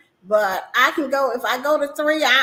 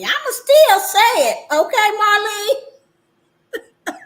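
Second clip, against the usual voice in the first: defiant, half laughing; 9 semitones higher, 7 dB louder, slower than usual. Real, not acted.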